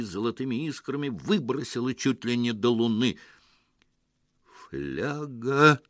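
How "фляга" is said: drawn-out word of grief; grieving, stretched-out lament; sorrowful mutter. A narrator's reading. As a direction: mourn it slowly. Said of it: "горестно ... пробормотал Петро".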